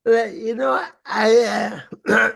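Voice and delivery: ordinary talk, nothing marked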